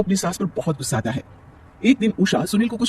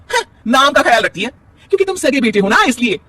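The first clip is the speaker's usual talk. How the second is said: angry insistence; 7 semitones higher, 4 dB louder than usual. Acted. Wife argues with husband.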